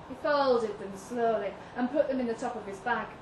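ordinary delivery

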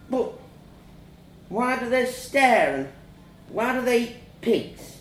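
put-on high, halting child's voice; puzzled child. A narrator's reading. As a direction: question it like a worried little boy. Child questions mum.

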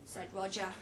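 ordinary delivery, nothing marked

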